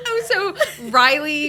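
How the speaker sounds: normal talking voice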